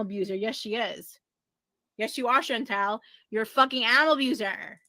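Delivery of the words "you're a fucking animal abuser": ordinary talk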